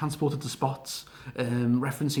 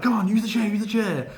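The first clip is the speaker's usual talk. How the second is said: taunting Bray; 7 semitones higher than usual. Retold or imitated. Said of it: "Bray was like".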